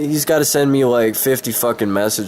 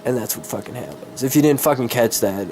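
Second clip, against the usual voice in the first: ordinary talk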